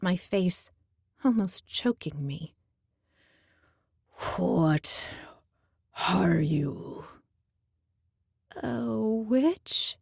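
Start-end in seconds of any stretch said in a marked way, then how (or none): 4.4-7.0 low, breathy beast voice; low Minotaur growl. A narrator's reading. Minotaur questioning the girl, low and menacing. 8.6-10.0 nervous. A narrator's reading. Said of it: "I asked nervously".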